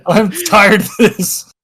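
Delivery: loud, high mock tirade; exasperated scolding. Player scolding Kratos for falling, loud exasperation. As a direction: bellow a fed-up order at a weakling hero. Acted scolding of the wounded hero.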